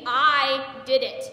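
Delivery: loud, slow stress on "I"; emphatic claim. Acted. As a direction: hit "I" hard and draw it out. Insists the deed was hers.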